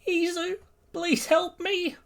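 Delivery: very high pleading voice; frightened mother begging for help; fearful, tearful. Acted. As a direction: plead in a thin falsetto. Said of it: "with fear and tears in her eyes".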